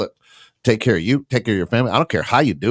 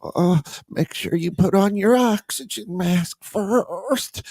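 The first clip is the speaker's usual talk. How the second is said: high, slow put-on flight attendant voice; a lightly hammed-up airline safety voice; mock announcer voice; 10 semitones higher, slower than usual. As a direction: recite it like a cabin safety announcement, higher and slowed down. Mocking a flight safety line.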